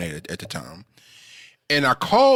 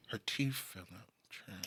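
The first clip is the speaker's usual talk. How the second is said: hushed whisper; 19 dB quieter, much slower than usual. Retold or imitated. Driver whispers grandma's teeth fell.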